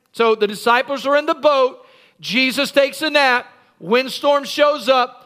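normal talking voice

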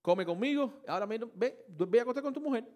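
high, loud, pushy royal coaxing; king cajoling his soldier, loud and high; loud urging king. Acted. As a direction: push him home with booming royal insistence. King urging Uriah go home.

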